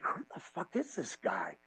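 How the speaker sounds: low, hushed disbelief; quiet stunned aside; stunned mutter. Retold or imitated. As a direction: say it under your breath, awestruck. Stunned inner reaction to wrestler.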